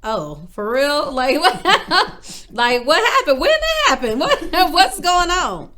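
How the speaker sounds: high, loud, laughing in disbelief; shocked disbelief. Retold or imitated. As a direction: blurt it out, stunned and half laughing. Reacting to pregnancy news.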